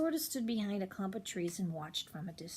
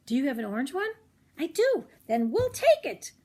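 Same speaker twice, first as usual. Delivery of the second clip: higher and louder, thrilled decision; eager girl; 6 semitones higher, 7 dB louder than usual. Acted. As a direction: snap it up happily. Rosie decides to buy.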